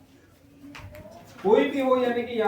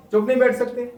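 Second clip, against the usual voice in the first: scolding; 4 semitones higher, 6 dB louder than usual. Shown as a demonstration. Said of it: "लेकिन दोनों के भाव अलग है".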